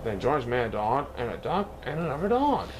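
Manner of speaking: usual speaking voice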